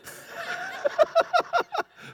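a real laugh, high and bright; high burst of laughter. Real, not acted. Preacher laughing to the congregation after the quote.

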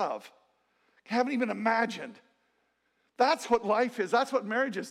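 loud, high-pitched preacher's delivery; full-force preaching. Real, not acted. Preacher telling the congregation what marriage is, preaching forcefully.

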